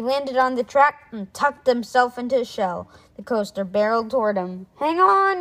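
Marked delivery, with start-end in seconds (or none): 4.8-5.4 high, loud warning yell. Acted. Alexander yelling a warning before the crash. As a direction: brace them with a shout. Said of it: "yelled Alexander".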